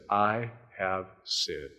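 slow, soft broken admission; contrite confession. A narrator's reading. David on his knees confessing to Nathan, slow and soft. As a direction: barely get the words out. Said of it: "David dropped to his knees".

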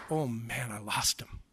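mock careless whisper, fast, with a laugh; tossed-off whisper with a chuckle; mock shrug. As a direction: play a shrugging God losing someone, hushed and quick. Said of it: "He's not gonna go".

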